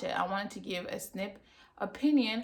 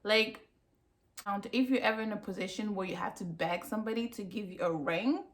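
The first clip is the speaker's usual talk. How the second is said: normal talking voice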